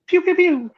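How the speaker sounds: very high little "pew" shots; playful laser-gun noises. Pretend. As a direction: echo toy gunfire in a high voice. Echoes the imitated gunfire.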